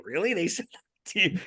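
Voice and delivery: high-pitched incredulous question; shocked. Real, not acted. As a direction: react in real disbelief. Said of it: "I'm shocked 'cause I'm like".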